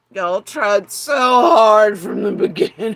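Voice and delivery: loud, laughing jeer; mocking shout. Real, not acted. Taunting the people watching her.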